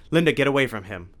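loud urgent warning; crying out. A narrator's reading. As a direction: shout it to save her. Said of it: "cried Simon".

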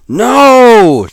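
high, loud desperate scream; shout. Acted. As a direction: yell in terror. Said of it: "Jason and Alex shouted".

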